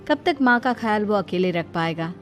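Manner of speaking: usual speaking voice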